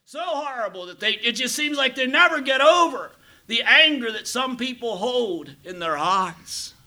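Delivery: rising high and loud, slowing at the end; fervent preaching. Real, not acted. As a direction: preach it with full fire. Preacher building up at sermon opening.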